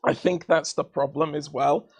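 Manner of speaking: usual speaking voice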